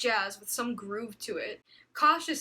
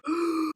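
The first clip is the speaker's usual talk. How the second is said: sharp gasp; 6 semitones higher, 4 dB louder than usual. Acted. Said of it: "woke up gasping for air".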